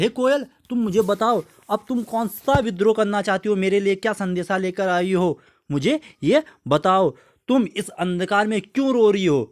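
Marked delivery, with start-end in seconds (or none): none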